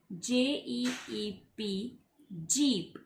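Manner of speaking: drawn-out letter by letter; spelling the word for pupils, slowly. Shown as a demonstration. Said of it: "spells letters before the word".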